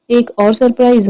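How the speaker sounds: ordinary delivery, nothing marked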